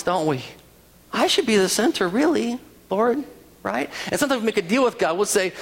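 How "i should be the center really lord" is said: higher-pitched mock protest to God; mock self-important pleading. People questioning God about who's center, mock self-important.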